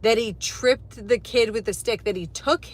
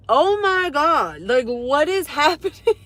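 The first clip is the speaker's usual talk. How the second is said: higher, slow stunned gasp of disbelief, laughing at the end; shocked; 5 semitones higher, 5 dB louder than usual. Retold or imitated. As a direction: relive the shock, drawn out and higher. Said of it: "And I'm like".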